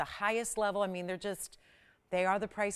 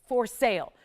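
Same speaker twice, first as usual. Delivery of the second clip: sale punched louder; stress on sale; 6 dB louder than usual. Shown as a demonstration. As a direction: lean hard on the word sale. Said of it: "And emphasize the word sale".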